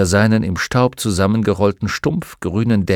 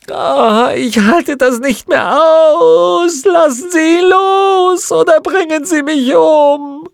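loud, very high drawn-out wail; desperate, crying shriek; agonised screaming; 9 dB louder, slower than usual. Acted. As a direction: scream as if tortured past endurance. Witch breaks under torture.

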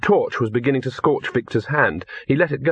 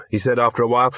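ordinary delivery, nothing marked

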